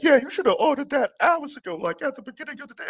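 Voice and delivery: high mocking voice of the coworker, rushed at the end. Coworker scolding the busboy, high mocking impression. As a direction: sneer it in a high, snippy coworker voice. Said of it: "She's like".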